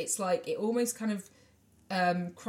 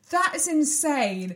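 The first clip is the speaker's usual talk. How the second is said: incredulous; 6 semitones higher, 3 dB louder, much slower than usual. Retold or imitated. Retelling own reaction to film.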